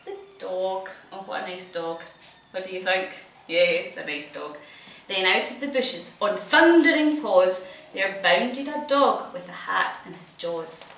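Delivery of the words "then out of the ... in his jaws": loud, lively read-aloud; reading to children. A narrator's reading. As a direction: perform the rhymes for a child. Reading picture book aloud.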